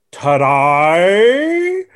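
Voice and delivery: put-on a student repeating the word in a Japanese accent; imitating a student's accent. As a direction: parrot the odd word back, bewildered. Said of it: "they go".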